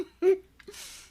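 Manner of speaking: real laugh, high and loud, almost crying; high helpless laugh. Real, not acted. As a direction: let the laugh burst out high. Laughs at own story.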